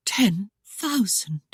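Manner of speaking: slow breathless whispered disbelief; stunned, barely voiced question; hushed astonishment, slow; astonished whisper. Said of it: "in questioning astonishment".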